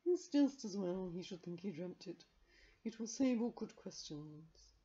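dry, slow cat aside; a wise cat's murmur. A narrator's reading. Carbonel voiced.

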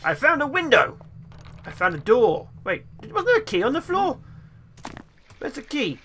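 usual speaking voice

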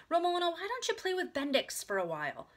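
coaxing. A narrator's reading. Big sister suggests the doll, coaxing. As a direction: coax a small child.

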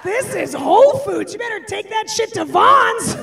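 hammed-up snooty shopper yelling; judgy shopper scolding. Judgy shopper scolds a tantrum mom, loud mocking shout. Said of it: "look that mother up and down".

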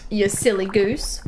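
ordinary talk, nothing marked

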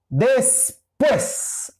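después drawn out slowly, pues pitched high and stressed. Shown as a demonstration. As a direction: split después, hit the final pues. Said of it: "Aquí lleva la mayor intensidad de sonido en pues". (después alargado, con la fuerza en pues)

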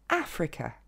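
Africa accentué sur le A, finale « que » à peine audible; prononciation anglaise. Shown as a demonstration. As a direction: dis Africa à l'anglaise : A-fre-que. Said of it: "C'est vraiment le son E". (Africa, A fort et voyelles suivantes réduites)

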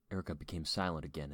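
ordinary delivery

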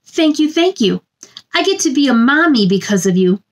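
plain reading voice

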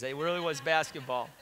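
ordinary delivery

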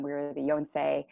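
ordinary delivery

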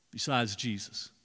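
ordinary talk, nothing marked

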